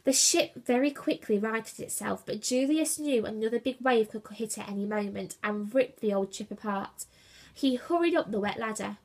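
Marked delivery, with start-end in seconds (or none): none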